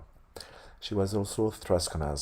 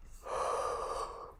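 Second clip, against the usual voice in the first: soft weary exhale; tired sigh; 5 dB quieter than usual. Real, not acted. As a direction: sigh from late-night tiredness. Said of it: "it's a, getting a bit late".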